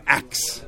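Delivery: AXE said for "ask", with k before s; broken English. Shown as a demonstration. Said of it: "speak a broken English".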